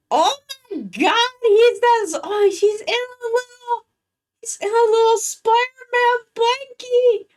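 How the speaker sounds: very high squeal over something cute; cooing delight. Real, not acted. Streamer gushing over a viewer's pet in a blankie, high squeal. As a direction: gush like seeing a puppy.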